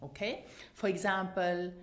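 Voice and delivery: normal talking voice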